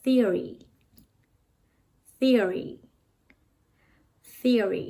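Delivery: Theory said clearly as a teacher's example; model pronunciation. Shown as a demonstration. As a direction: say Theory slowly and clearly for students to repeat. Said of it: "ออกเสียงว่าอะไร?". (ออกเสียงคำว่า Theory ให้นักเรียนพูดตาม)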